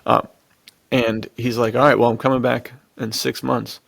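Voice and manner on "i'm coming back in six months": usual speaking voice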